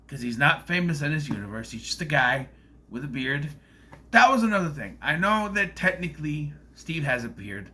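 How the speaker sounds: ordinary delivery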